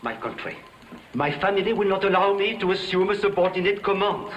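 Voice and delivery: normal talking voice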